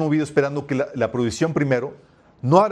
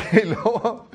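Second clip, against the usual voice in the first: real laugh spilling into the words; laughing through words; 9 semitones higher, 4 dB louder, slower than usual. Real, not acted. Preacher still laughing while retelling his dad's tears. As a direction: keep laughing as you go on.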